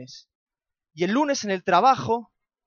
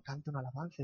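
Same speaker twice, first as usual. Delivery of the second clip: much softer, musing repeat of "Canta una alabanza"; puzzled inner echo, softer; thinking to himself; 5 semitones lower, 17 dB quieter, faster than usual. Retold or imitated. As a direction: turn "Canta una alabanza" over in your head, barely voiced. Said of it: "Yo me quedé pensando".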